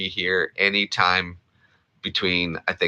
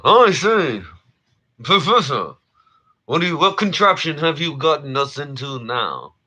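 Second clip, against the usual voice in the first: Thurston Howell impression; 8 semitones higher than usual. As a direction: play the pompous millionaire, nose in the air. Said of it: "that's Thurston Howell the third".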